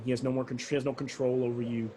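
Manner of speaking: normal talking voice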